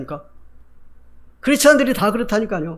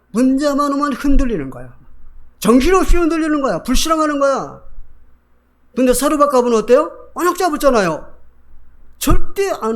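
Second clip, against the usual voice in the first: high, loud emphatic preaching; preaching at full force; 7 semitones higher, 5 dB louder than usual. Acted. Preacher scolding the wavering Israelite widows, emphatic. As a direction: drive it home to the congregation.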